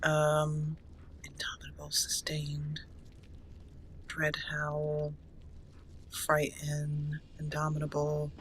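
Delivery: soft whisper while reading skills; whispered to herself. Real, not acted. Player reading skill names to herself, whispering. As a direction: murmur the list under your breath.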